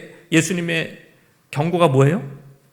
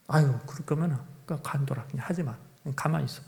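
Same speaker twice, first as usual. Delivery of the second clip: 그냥 가만히 있으라며 속삭이듯 내뱉는 스승; tired hushed words of a master sending him away; whispered dismissal; 3 semitones lower, 9 dB quieter than usual. Retold or imitated. Master dismisses failing apprentice. (지친 스승이 제자에게 속삭이듯 그만두라고)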